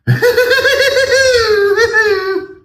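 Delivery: shrill high cackling laugh, loud; mock someone's wild high laugh; over-the-top squealing laugh; Tucker Carlson laugh. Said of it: "his laugh is".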